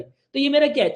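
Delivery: ordinary talk, nothing marked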